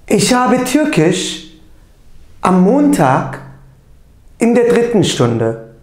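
slow, loud, careful German model sentences; teacher reading German aloud, deliberate and loud; pronunciation drill. Shown as a demonstration. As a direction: say each German phrase clearly for the class to repeat. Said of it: "doğru telaffuzuyla tekrarlayalım".

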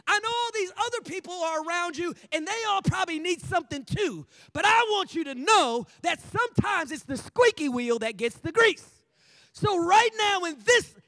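loud, insistent pitch for attention; urgent beggar's appeal. Acted. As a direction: keep calling out over the crowd.

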